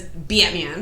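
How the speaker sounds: Batman drawn out with a Jersey vowel; slow accidental Jersey accent on Batman. Shown as a demonstration. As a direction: stretch Batman into "Bee-uht-man", Jersey-style. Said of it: "it'll come out like that".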